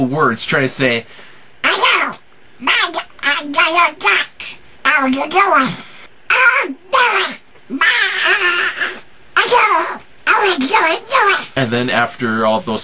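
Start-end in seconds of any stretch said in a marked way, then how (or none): 1.6-11.5 high squawky cartoon duck; Donald Duck voice. Shown as a demonstration. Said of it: "how to do a Donald Duck impression".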